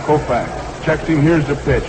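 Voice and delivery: normal talking voice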